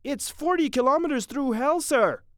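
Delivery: high, loud soldier's report; urgent sergeant. Acted. Sergeant reporting to Captain Mitty, high, loud and urgent. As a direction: report it urgently over the guns.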